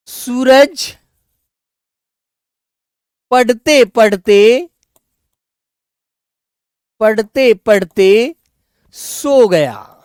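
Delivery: drawn-out words with pauses for writing; teacher dictating an example sentence slowly; slow example read-out. Shown as a demonstration. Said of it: "जैसे मैंने कहा आपके सामने".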